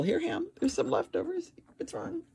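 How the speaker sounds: high, soft coaxing voice; pet talk. Real, not acted. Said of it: "talking sweetly to the cat".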